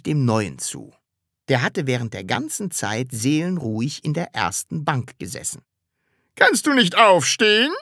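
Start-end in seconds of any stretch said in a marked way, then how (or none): 6.3-7.7 high, loud reproachful question; stern demand. A narrator's reading. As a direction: challenge the pupil like a strict teacher. Strict teacher confronts new pupil. (laut und streng gefragt)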